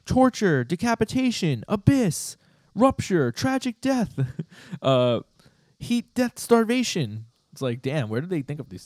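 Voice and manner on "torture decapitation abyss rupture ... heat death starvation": high, loud hammed-up scream of dark words; mock screaming. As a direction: shriek each word like a tiny doom goddess. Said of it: "she's just screaming".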